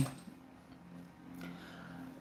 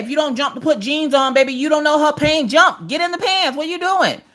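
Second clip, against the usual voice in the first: higher, sung-ish coaxing jump into the pants; 10 semitones higher than usual. Retold or imitated. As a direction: hype the kid into the jeans, higher. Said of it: "it's like [name] said".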